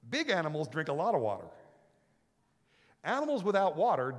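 loud sermon storytelling; fired-up preaching. Real, not acted. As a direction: preach it out big. Preaching at full force.

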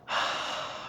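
a long breathy sigh out; captain's sigh. A narrator's reading. The captain sighs after the talk, long breath out. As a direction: let the air out in a tired sigh. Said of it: "El capitán suspiró".

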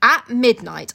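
usual speaking voice

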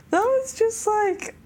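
ordinary talk

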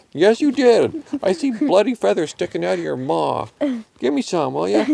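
high, forceful cat voice; pushy cartoon cat demand; accuse, pitched well up; accusing Randy. A narrator's reading.

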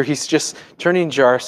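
usual speaking voice

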